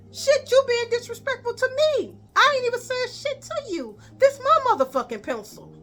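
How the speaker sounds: very high angry comeback; heated retort. Retold or imitated. Student to teacher over pencil, very high heated retort. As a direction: throw it back at her high and heated.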